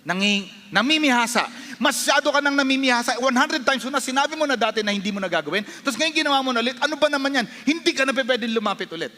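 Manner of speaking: high, fast exasperated scolding; rushed, accusing rebuke; condemning voice. Acted. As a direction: berate a repeat offender who has gone too far. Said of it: "That's the condemnation talking".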